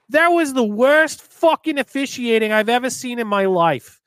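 put-on loud, high Boston accent rant; mimicking an old Boston fan. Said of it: "He's like".

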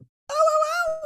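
very high excited oh oh oh; excited squeal. Real, not acted. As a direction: squeal with sudden delight. Excited at Capri Sun.

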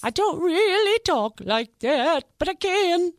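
high, loud, very slow put-on drawl; hammed-up madwoman voice; crazy lady voice. Shown as a demonstration. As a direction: show off the silly character voice, stretched out. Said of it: "your true voice".

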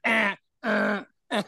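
repeated odd little grunts; mock weird grunts. Mocking the officer who kept interrupting him with weird grunting noises. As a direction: imitate a man grunting to cut you off. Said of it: "these weird noises".

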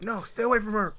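high, pleading cry of protest; helpless plea. Retold or imitated. Telling Carl to stay away from mom, high pleading voice. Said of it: "I was like".

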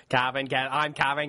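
mock English accent with broad vowels; hammed-up, failing English accent; Kevin Costner's bad accent. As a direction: introduce yourself in a badly faked English accent. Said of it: "trying to do this really bad accent".

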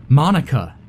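loud, high holler of a name. A narrator's reading. Ellie hollers to Monica while fighting. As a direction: shout across without looking. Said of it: "I hollered".

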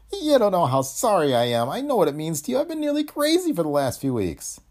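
high, pleading, guilt-ridden voice; distraught Greg-type employee. A narrator's reading. Employee apologizing to Tony, distraught. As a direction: apologize in a high, choked-up character voice.

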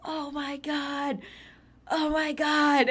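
high, loud, drawn-out freak-out; hammed-up slow panicked scream; imitated screaming. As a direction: mimic a friend shrieking in panic. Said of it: "he starts screaming".

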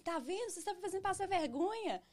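high-pitched retold reproach; indignant I-told-you-so. Scolds family at doctor's, retold. (bronca indignada, em tom bem agudo)

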